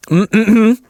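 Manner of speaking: ordinary talk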